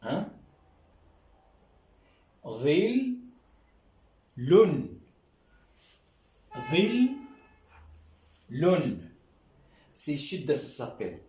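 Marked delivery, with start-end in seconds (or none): 2.4-8.8 "Ril" stretched and rising, then "lun" falling, with pauses; syllable-by-syllable reading. Shown as a demonstration. Teacher showing how to read the word, "Ril", "lun" separately. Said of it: "Comment on va lire?".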